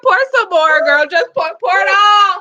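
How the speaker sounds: high, laughing yell; mock egging-on yell. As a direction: whoop it like a rowdy drinking buddy. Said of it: "She said".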